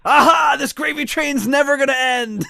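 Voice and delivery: high, loud gloating cry; gloating shout. Retold or imitated. Mocking the German voiceover guys, high gloating shout. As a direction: crow it like you're rich forever.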